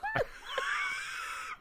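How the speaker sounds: very high, helpless real laughter; breaking up in a high cackle; shrieking laugh. Real, not acted. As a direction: laugh in a long high squeal.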